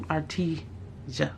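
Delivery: "Artesia" slowly broken up, ar-TEE... zhuh, quietly; tentative pronunciation. Real, not acted. Vlogger settling on a brand name, tentative pronunciation. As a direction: sound out "Artesia" slowly and softly with a zh. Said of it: "I don't know how to pronounce it".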